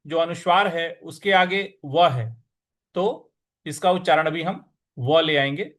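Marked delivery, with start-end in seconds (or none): none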